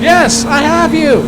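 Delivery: slow, loud shout, pitched high; shouting back across the storm; yelling over hurricane winds. Pretend. Anchor answering storm reporter.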